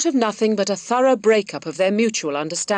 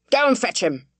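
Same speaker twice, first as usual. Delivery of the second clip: final barked order; 5 semitones higher than usual. A narrator's reading. Squire repeating his order to fetch Dunsey, angry command. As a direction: end it with a flat command.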